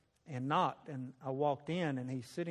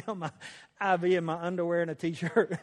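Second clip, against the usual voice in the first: ordinary talk, nothing marked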